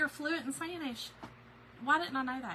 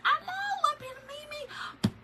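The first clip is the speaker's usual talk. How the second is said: squealing delight; 3 dB louder, slower than usual. Real, not acted. Reading viewer comment, moved.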